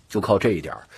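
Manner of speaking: ordinary delivery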